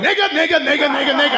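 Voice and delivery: high, eager pointing out landmarks; an excited old dad naming every sight; dad sightseeing. Retold or imitated. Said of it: "He's going".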